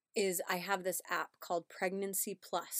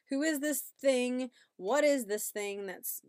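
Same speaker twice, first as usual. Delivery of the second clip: higher, louder mock disbelief; indignant, astonished youngest sibling; bewildered child; 5 semitones higher, 7 dB louder than usual. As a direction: voice a put-out little one, incredulous.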